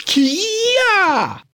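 very high, drawn-out fighting cry; martial-arts yell. Acted. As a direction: let out a karate kiai. Dragon's fighting cry while beating ninjas. (бойовий крик каратиста)

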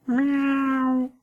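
high mewing meow; cat meow. A narrator's reading. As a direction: mew like a kitten. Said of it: "кто-то опять сказал". (кошачье мяуканье)

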